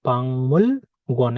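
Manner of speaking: "পাংমুল গুয়ান" with ng before m; nasalised pronunciation. Shown as a demonstration. Teacher answering with the changed sound, nasalised pronunciation. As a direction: join "পাংমুল" with a held ng. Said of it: "এরকম উচ্চারিত হবে".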